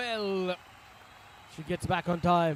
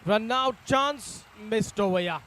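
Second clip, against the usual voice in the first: higher, louder and slow; excited shout; 4 semitones higher, 6 dB louder, slower than usual. Real, not acted. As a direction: call the near miss urgently. Sudden run out chance.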